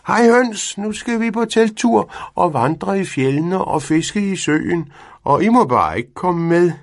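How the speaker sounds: high, loud taunting call; teasing shout. A narrator's reading. Cat shouting at the hens that they can't come, high and teasing. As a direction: crow at the hens to make them jealous. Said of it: "råbte Findus".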